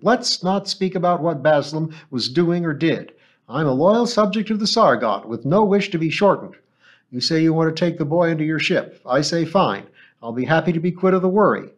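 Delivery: put-on an incredulous old woman, scandalized; alarmed old woman. Mother Schaum, nervous loyal subject.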